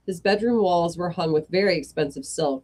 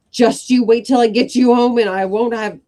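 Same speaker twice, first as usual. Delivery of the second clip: loud, higher scolding shout; mum shouting; 5 semitones higher, 8 dB louder than usual. Acted. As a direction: yell at the kids. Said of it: "moms shouting".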